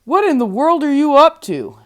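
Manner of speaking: donkey demanding an answer from the rooster, loud; loud exclamation. A narrator's reading. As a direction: shout the question at the rooster. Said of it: "the donkey exclaimed".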